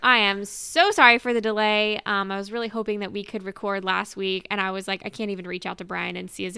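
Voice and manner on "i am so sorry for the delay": ordinary talk, nothing marked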